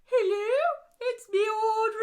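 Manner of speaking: put-on drawn-out high old lady greeting; Audrey impression.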